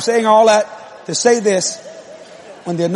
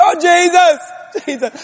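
high, loud "Oh, Jesus"; loud praying imitation; 8 semitones higher than usual. Preacher shows wife's new way of praying, loud mock cry. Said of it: "But she's going".

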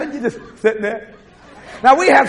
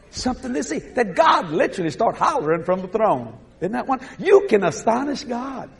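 normal talking voice

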